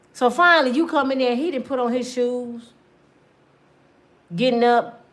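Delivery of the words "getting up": ordinary delivery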